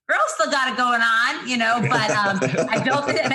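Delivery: cheering herself on after a pitch; sassy self-praise. Retold or imitated. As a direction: hype yourself up like a diva. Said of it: "I was like".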